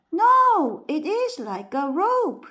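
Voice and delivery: loud, high yell. A narrator's reading. Sixth blind man yelling back during the quarrel. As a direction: shout him down. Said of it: "yelled the sixth".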